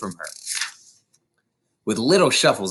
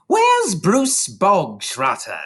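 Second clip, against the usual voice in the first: loud, slow barked question; barking demand; 9 semitones higher, 3 dB louder, slower than usual. A narrator's reading. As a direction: bark it across the hall. Headmistress calls the boy.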